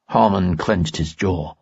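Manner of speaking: ordinary delivery, nothing marked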